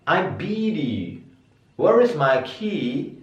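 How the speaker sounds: "beat" and "key" held long and high, slowly; slow high demo. Shown as a demonstration. Teacher modelling long vowels for students, slow. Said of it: "harus dibaca tinggi".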